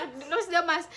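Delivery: normal talking voice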